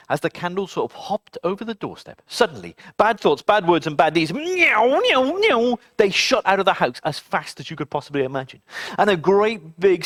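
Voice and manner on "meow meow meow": mock meows. Bad things flee.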